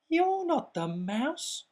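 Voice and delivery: high, challenging little monster voice; a small monster squaring up to a stranger; Gruffalo's child. Acted. Gruffalo's child to snake, high and challenging.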